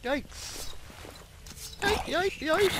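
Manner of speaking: yikes. Real, not acted. Startled by something in mine.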